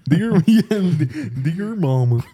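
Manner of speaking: a hint of a dopey character calling out; quoting the movie guy through laughter, lightly voiced; Ridiculous Six character voice. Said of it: "the guy from The Ridiculous Six".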